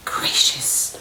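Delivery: soft, whispered amazement; awed whisper. Real, not acted. Vlogger marvelling at her new bag, whispering. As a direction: whisper it in awe.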